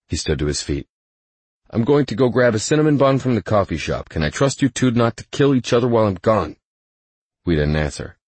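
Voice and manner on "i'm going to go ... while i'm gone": higher-pitched, mildly wary mediator; a hint of a weary chaperone, pitched up; lightly voiced Brenton. Go-between leaving the feuding pair, lightly voiced wariness.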